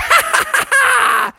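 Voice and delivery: very high shrieking cackle; Bubby cackling at his spooked family; gleeful laugh. Acted. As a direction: laugh your head off at the prank.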